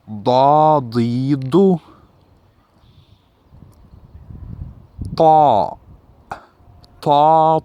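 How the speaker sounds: loud, crisp syllables with a pause after each; alphabet pronunciation drill. Shown as a demonstration. Teacher drilling Arabic letters for the class, loud and crisp. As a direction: call out each letter and vowel for the class to copy. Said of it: "The pronunciation".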